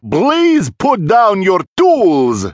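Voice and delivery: grand, ringing, measured command; calm yet booming. A narrator's reading. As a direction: boom it out with unhurried authority. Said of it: "in a very calm yet booming voice".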